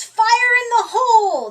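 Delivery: high, loud warning shout. Acted. Demolition crew warns everyone before the blast, shouting high.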